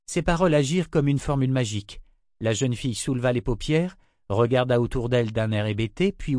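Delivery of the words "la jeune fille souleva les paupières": ordinary delivery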